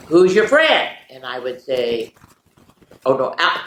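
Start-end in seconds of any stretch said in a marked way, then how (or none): 0.1-1.0 called out question. Retold or imitated. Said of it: "somebody else would say".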